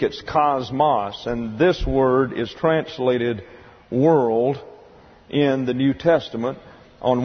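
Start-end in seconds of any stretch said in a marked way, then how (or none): 0.2-1.3 cosmos said slowly as KOZ-moss; Greek pronunciation. Shown as a demonstration. Teacher returning to the Greek word, slow KAHZ-mahs for cosmos. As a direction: say cosmos as "KAHZ-mahs", short final vowel. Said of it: "in the Greek".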